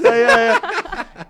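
high drawn-out laughing ay ay ay; laughing cry. Real, not acted. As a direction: let the ay ay ay stretch out on a laugh. Risa tras confesión graciosa. (ay ay ay largo y agudo entre risas)

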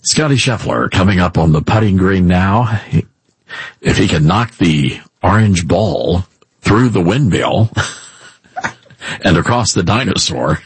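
low, deliberate golf commentator. Pretend. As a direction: announce a putt with solemn, low-voiced suspense. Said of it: "talk in a golf voice".

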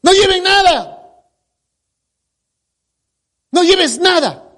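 agudo y tajante, citando una orden; orden enfática citada. Retold or imitated. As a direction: proclama la orden con tono alto. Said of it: "Qué, qué dijo?". (mandato citado con énfasis agudo)

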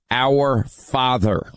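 Our Father said slowly and weightily; slow emphasis. Shown as a demonstration. Host pointing callers to the prayer, slow and weighty. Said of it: "That part".

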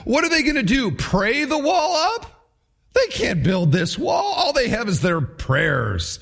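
hammed-up ridicule; ridiculing jeer. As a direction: jeer at their faith like a heckler. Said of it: "He ridicules their optimism".

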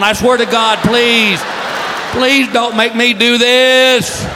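hammed-up wailing plea; desperate pleading. Husband begs wife to spare him the errand, wailing. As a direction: beg with drawn-out, whining dread.

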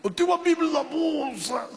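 imitated high, strained wail; groaning in pain. Injured pastor telling a rescuer about himself, groaning in pain. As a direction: squeeze it out as a mock agonized groan.

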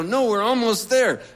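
high, loud nervous cover-up; flustered loud reply. Retold or imitated. Storyteller covering up the wrong turn to [name].

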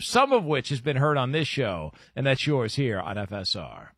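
polished ad read into show promo; radio host read. Acted. Host reading sponsor and upcoming segments, radio read. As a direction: drive through the copy, punching the teases.

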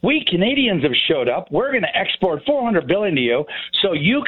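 mock-cheery sales pitch, pitched high; sarcastic. Guest voices Canadians greeting Europe with absurd export offer, sarcastic. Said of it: "I'm being sarcastic".

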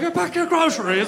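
loud, very high mock British heckle; Winston Churchill. Statesman joining in against customer, hammed-up British yell. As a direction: imitate an indignant famous Englishman, hammed up. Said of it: "Winston Churchill was like".

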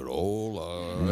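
very low, drawn-out mock greeting; child mimicking hello. Father retelling his children copying the catchphrase, deep drawled hello. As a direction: drag the hello out in a deep put-on voice. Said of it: "y hace".

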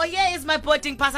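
put-on high Nigerian-accented insistence; mock Nigerian passenger. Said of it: "do it in a Nigerian accent".

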